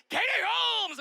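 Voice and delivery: high, loud shouted name; ecstatic shout. Retold or imitated. Imitating Tom Cruise on Oprah's couch, high loud shout. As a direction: yell it while jumping on the couch. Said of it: "dancing on the couch".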